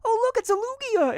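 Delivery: put-on very high whining kid; whiny voice. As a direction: pipe it up like a bratty kid. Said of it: "he'll just be like".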